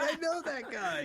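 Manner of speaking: laughing mock. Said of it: "I'm like".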